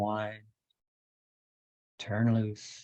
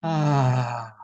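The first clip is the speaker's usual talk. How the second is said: loud voiced sigh, falling; 3 semitones higher, 10 dB louder than usual. Shown as a demonstration. Meditation leader breathes out after the bell, loud sigh. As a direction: let the breath go on a big "ah". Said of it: "perhaps with a sigh".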